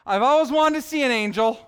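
thrilled schoolgirl gushing over seeing an angel; excited Mary. Acted. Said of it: "Now, is she excited?".

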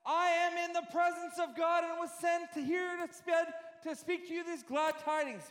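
excited preaching as Gabriel announcing glad tidings; excited angel. Acted. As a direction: proclaim it like a thrilled herald. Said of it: "See, I'm getting excited".

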